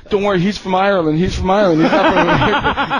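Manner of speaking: laughing. Retold or imitated. Friend excusing drunk Novak.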